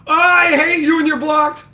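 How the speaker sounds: high, whining mock sob; mock crybaby. Streamer mocking the viewer who blocked him, fake crying whine. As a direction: whimper it like a sulking child.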